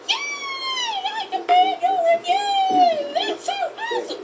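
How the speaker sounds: high, excited cartoon cheer; high-pitched delight; Diddy Kong. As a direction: celebrate in a very high character voice. Diddy cheers at being let in.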